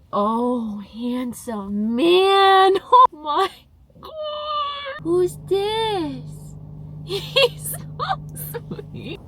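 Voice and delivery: high, slow cooing pet voice with laughs. Real, not acted. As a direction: gush over the dog. Cooing at her dog.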